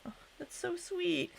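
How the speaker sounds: high, soft gushing; touched delight. Real, not acted. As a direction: coo your thanks, genuinely touched. Still touched by the gift.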